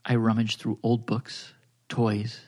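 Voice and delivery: low, soft, slow seductive narration; sultry storyteller voice. Acted. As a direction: read it like a bedtime story for grown-ups. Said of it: "you're really doing the job".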